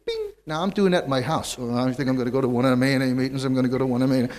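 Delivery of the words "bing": bing squeaked way up high like a game beep; ping out "bing" in a tiny high electronic voice; arcade bing sound. Shown as a demonstration. Describing the arcade bear game, imitates the high beep. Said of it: "It goes bing".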